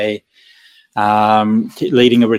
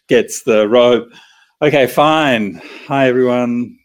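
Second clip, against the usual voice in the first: normal talking voice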